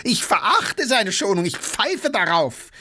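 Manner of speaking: high, scornful outburst; raging. A narrator's reading. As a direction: spurn his mercy in a fury. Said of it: "tobte Gaganow". (tobend und verächtlich)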